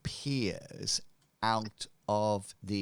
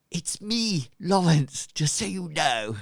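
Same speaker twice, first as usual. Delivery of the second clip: higher, louder grand announcement; robed leader revealing himself, higher and louder; hooded cult leader; 7 semitones higher, 7 dB louder than usual. Acted. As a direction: unveil yourself to the crowd with pomp.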